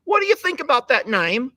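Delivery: a posher mock British reading of the question; British accent. Host repeats the question in a British accent. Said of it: "Do I sound anything like British?".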